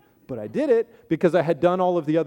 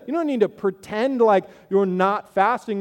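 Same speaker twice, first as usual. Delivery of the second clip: ordinary talk, nothing marked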